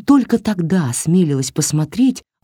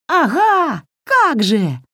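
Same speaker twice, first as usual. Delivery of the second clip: talking doll; 10 semitones higher, slower than usual. A narrator's reading. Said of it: "завопила Васька".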